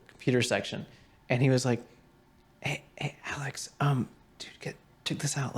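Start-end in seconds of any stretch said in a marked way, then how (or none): 2.6-5.6 conspiratorial library whisper. Retold or imitated. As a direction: whisper it so the teacher won't hear.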